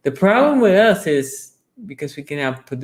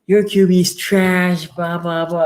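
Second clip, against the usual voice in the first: trash talk taunt; 6 semitones higher than usual. Retold or imitated. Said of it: "we had Flacco back then".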